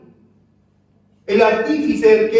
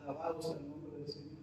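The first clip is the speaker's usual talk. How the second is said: much softer and lower, quick; a private breath of praise; murmured praise; 8 semitones lower, 24 dB quieter, much faster than usual. Real, not acted. Preacher murmuring praise to himself, much softer.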